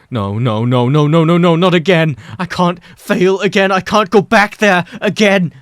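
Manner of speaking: spiral into panic; panicked escalation. A narrator's reading. Mike panics about failing again, building to frantic loud cries.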